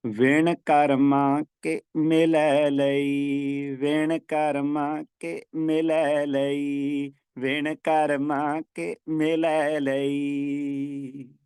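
very slow chant with Karma ਕੇ joined together; slow teaching chant, Karma run on into ਕੇ; no pause at Karma. Shown as a demonstration. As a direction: repeat the line slowly, no break after Karma. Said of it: "ਪਰ ਇੱਥੇ".

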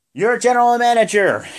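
high, loud hammed-up announcement; Vince. Boss naming the general manager, big announcer yell. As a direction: bellow it like a showman boss. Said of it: "And Vince is like".

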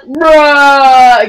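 one word held out loudly, level pitch; long loud bruh. Retold or imitated. Kid replying to the pizza guy, long loud bruh. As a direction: drag it out as a loud mock comeback. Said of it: "Then I'm like".

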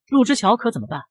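plain reading voice

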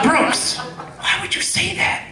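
hammed-up shocked girlfriend in a high whisper; girlfriend scolding. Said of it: "I'm like".

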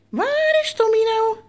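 high singsong with a long first word. Pretend. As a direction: stretch it out like hide-and-seek. Same call, stretched out.

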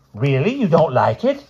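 rising, surprised question. Acted. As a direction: sound caught off guard. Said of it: "said Mister Smith in surprise".

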